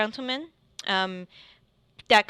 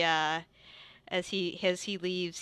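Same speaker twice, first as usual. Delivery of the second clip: ordinary delivery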